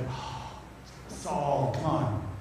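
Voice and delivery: soft, sighing exasperation; dismayed sigh. Acted. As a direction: sigh it out, deflated and low. Pleading with Saul in dismay.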